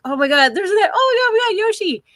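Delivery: very high, loud squeal of excitement; excited kid squeal. Retold or imitated. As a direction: squeal it like a thrilled child reliving the moment. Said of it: "we were so excited".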